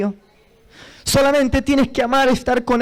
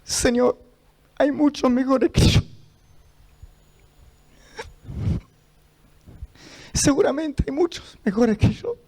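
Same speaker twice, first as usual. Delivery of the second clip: choked up; 2 semitones higher, 3 dB quieter than usual. Real, not acted. Choked confession after recalling weeping.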